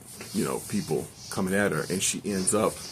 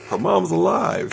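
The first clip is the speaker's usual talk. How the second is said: excited disbelief; 12 semitones higher, 8 dB louder than usual. Real, not acted. After reveal.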